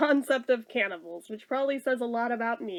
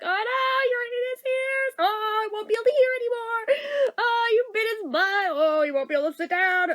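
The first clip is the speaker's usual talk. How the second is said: high, playful fake panic; hammed-up horrified mom; mom's mock alarm; 8 semitones higher than usual. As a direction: tease like a mom pretending the bunny is hurt. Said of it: "she was like".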